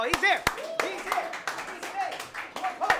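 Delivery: high, repeated hype calls; excited cheering. Real, not acted. Host welcoming the next poet up, excited cheering. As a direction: whoop the next act up in a high voice.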